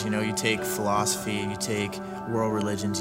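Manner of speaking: usual speaking voice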